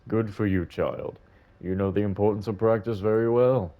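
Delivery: put-on old man. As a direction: speak as a gentle elderly gentleman.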